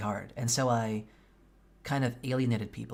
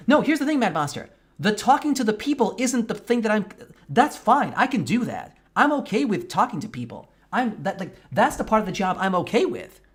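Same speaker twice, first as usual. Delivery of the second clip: loud, much higher, exasperated; heated rant; 9 semitones higher, 8 dB louder than usual. Real, not acted. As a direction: push back hard, almost shouting. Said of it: "I am so fucking pissed".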